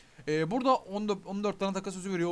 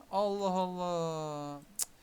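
drawn-out, slow exasperated sigh of words; 2 semitones lower, much slower than usual. Real, not acted. Streamer fed up with the game's drops, slow exasperated. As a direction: drag it out in disbelief.

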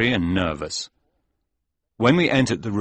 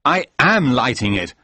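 angry; 4 semitones higher, 5 dB louder than usual. Acted. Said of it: "I said angrily".

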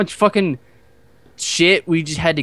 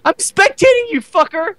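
high, gleeful gotcha taunt; excited taunt; 13 semitones higher, 4 dB louder than usual. Real, not acted. Gamer taunting his friend on joining, gleeful high taunt. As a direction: crow it over your friend.

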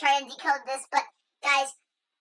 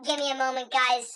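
ordinary talk

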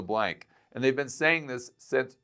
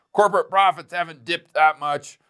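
mocking quote; 6 semitones higher, 4 dB louder than usual. Mocking what the critics always say.

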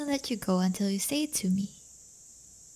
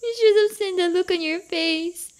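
higher, louder gleeful teasing; teasing gloat; 7 semitones higher, 6 dB louder than usual. Real, not acted. Mocking her date for falling for it, gleeful teasing. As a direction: crow over the prank you pulled.

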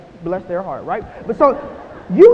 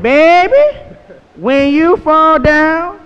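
slow, louder grandma's call; 6 semitones higher, 11 dB louder, much slower than usual. Retold or imitated. Speaker quotes his grandmother to the audience, slow. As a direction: stretch it out like grandma. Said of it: "She said".

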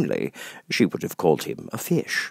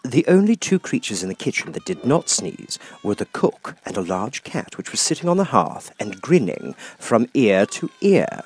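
plain reading voice

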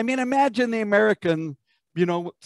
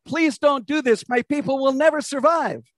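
raised, high-pitched acted plea; pleading; 6 semitones higher than usual. Amos begging God not to burn the nation, pleading. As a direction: plead urgently for mercy. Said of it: "Amos pleads with God".

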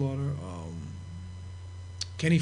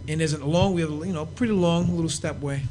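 usual speaking voice

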